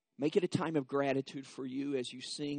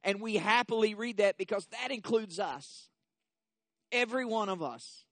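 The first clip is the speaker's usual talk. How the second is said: preaching that climbs louder and higher through the list; building preaching; 7 semitones higher, 7 dB louder than usual. Acted. Pastor rallying the congregation, growing louder and higher. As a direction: stack each group on the last, rising to a full pulpit shout. Pastor continues at full force.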